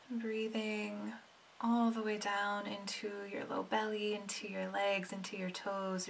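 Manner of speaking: soft, unhurried meditation voice with long pauses; hushed, soothing meditation lead; guided meditation. Acted. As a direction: gently guide them inward, letting each phrase settle.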